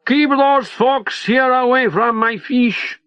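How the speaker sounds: put-on high, slow Latin-accented warning. A narrator's reading. The captain waves his pistol at Leo, high slow Latin accent. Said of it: "says in a high Latin accent".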